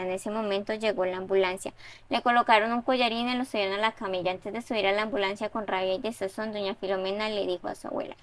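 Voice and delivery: steady, even read-aloud of a story; story narration. A narrator's reading. Teacher reading a student's story aloud, even narration. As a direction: read the student's text out to the class.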